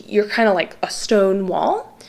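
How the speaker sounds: ordinary talk, nothing marked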